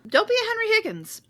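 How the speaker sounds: fast, high mock British accent; clipped posh English delivery. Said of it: "now your accent sounds better than mine".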